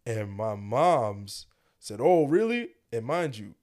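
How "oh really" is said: raised, louder mock-mom question; a mother's ominous "is that so", imitated; imitating an indignant mom. Voicing mom hearing the snitching.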